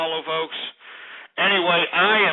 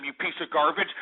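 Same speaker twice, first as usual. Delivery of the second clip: ordinary talk, nothing marked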